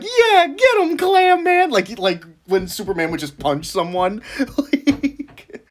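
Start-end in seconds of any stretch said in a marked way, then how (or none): 0.0-1.7 high, eager cheering crowd voice; cheering bystander. Retold or imitated. Bystanders cheering Clam Man on, high eager cheer. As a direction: pitch way up and cheer him on. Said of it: "Everyone would be like".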